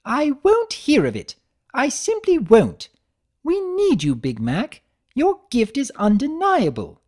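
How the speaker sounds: high, theatrical insistence; insistent Rarity. Acted. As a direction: play a fashion mare insisting, high and loud. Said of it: "she stomped a hoof".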